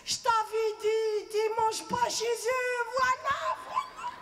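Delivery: high, slow, loud mother's voice gloating; hammed-up mother saying I told you so; mom's told-you-so. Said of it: "Elle m'a dit".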